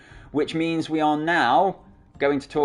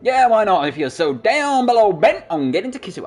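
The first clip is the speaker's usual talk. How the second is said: high, loud southern country twang; southern country accent; 6 semitones higher, 5 dB louder than usual. Acted. Game captain answering the player, put-on southern country accent. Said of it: "she went all southern country there".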